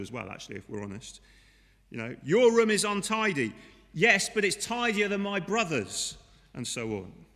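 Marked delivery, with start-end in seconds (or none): 2.2-3.5 scolding parent. Acted. 3.9-6.2 put-on defensive child. Child answering back.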